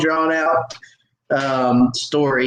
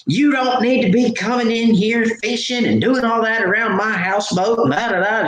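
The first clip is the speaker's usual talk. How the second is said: high, ranting imitation of an irate woman; going off; 8 semitones higher than usual. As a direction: mimic her scolding in a raised pitch. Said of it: "She's just going off".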